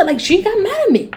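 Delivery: usual speaking voice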